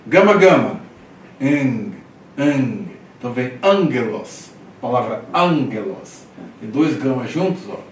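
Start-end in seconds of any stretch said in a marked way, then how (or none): 0.1-2.9 gama loud, then ang with a nasal ng; double gamma demo. Shown as a demonstration. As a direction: turn the first gama into ang.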